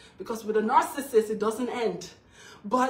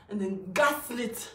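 normal talking voice

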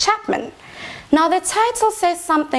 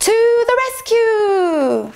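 rallying cry; 8 semitones higher than usual. Acted. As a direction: call out like a hero charging in. Children rallying to save Kate.

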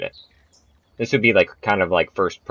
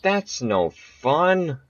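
slow sulky whine; 9 semitones higher, much slower than usual. Real, not acted. After failed shot.

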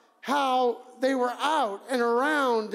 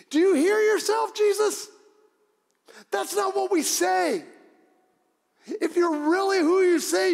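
loud, high, outraged heckle; incredulous shouting; 7 semitones higher, 5 dB louder than usual. Acted. Onlooker scolding Jesus for forgiving his killers, angry shouting. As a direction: shout him down in disbelief.

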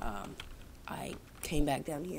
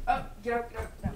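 higher, urgent repeated command; shooing the dog; 7 semitones higher, 4 dB louder, faster than usual. Real, not acted. Owner letting the dog out, urgent high shooing. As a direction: shoo it out in quick bursts. Sends dog outside, away from mic.

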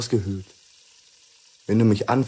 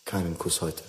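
quiet refusal; 5 semitones lower, 9 dB quieter than usual. Acted. Husband refuses wife's kiss.

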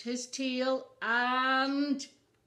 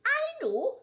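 very high, loud bird squawk; squawking puffin; 7 dB louder than usual. A narrator's reading. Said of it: "squawked Puffin".

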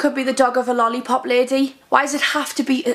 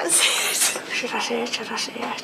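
each take softer and softer; fading to a whisper; 4 semitones lower, 7 dB quieter than usual. Real, not acted. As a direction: let it die down to a whisper.

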